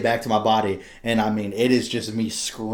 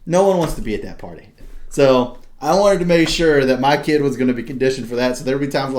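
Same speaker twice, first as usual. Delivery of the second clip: usual speaking voice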